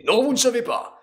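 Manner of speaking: high-pitched angry contradiction; Udina, angry. Acted. Councillor contradicts Commander Shepard, acted anger. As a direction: cut the commander off angrily. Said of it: "un peu plus en colère".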